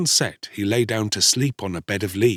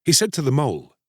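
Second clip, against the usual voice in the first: ordinary delivery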